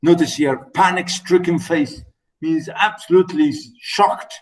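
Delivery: ordinary talk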